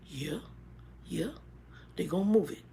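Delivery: very soft, short replies in his voice. Retold or imitated. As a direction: imitate his quiet, matter-of-fact answers. Voicing Grumpy's answers in the dialogue.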